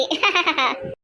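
hushed amused laugh; soft laugh. Acted. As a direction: chuckle quietly at the joke. Laugh after joke.

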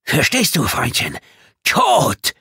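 high, loud shouted threat; enraged bellowing; furious roar. A narrator's reading. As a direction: roar it in rage, peaking on the last word. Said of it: "brüllte er".

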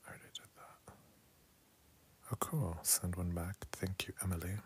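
soft unvoiced whisper. Real, not acted. Streamer whispering to viewers late at night. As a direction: keep it barely audible.

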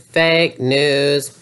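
ordinary talk, nothing marked

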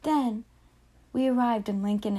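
usual speaking voice

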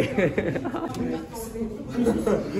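laugh. Real, not acted. Friends stuck in lift.

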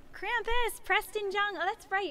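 high, delighted call of recognition; bright-pitched, happy surprise; excited greeting. Real, not acted. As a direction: greet a surprise guest by name. Greets a viewer who just arrived.

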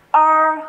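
O said as "er" with the tongue curled up, held level; curled-tongue vowel. Shown as a demonstration. Teacher introducing the sound, retroflex O. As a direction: curl the tongue up on O and sustain. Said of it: "phải uốn lưỡi lên khi đọc".

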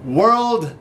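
building showman introduction; hype announcer. Acted. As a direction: sell the guest to the crowd. Hypes up guest like an announcer.

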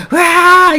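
high-pitched imitation of a father's outburst; mock angry yell. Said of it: "막 화를 내셨을까".

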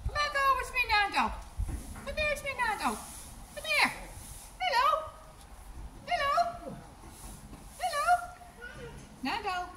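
high sing-song coaxing calls; high carrying calls to a horse in a field; calling the horse. Real, not acted. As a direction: call the horse over, voice pitched right up.

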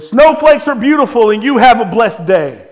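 loud, projected comeback; loud retort. Retold or imitated. Answering a heckler in the gas station, loud retort. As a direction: throw the blessing back at full volume.

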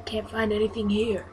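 usual speaking voice

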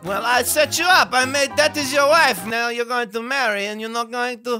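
comic Kazakh character voice; Borat. Acted.

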